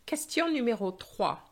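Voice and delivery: loud, clear announcer read; exam question read-out. Shown as a demonstration. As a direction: announce the question number crisply. Reads exam question aloud.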